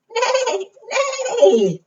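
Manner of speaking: high whinnying "neigh", then dropping; horse neigh. Acted. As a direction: imitate a horse for small children. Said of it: "Do you know what the horse says?".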